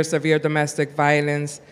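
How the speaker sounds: normal talking voice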